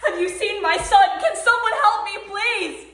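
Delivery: loud, high cry for help; desperate pleading. Acted. Mother begging someone to help find her son, desperate. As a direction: shout it out, begging anyone to answer.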